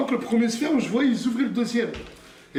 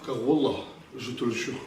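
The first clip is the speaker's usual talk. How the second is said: ordinary delivery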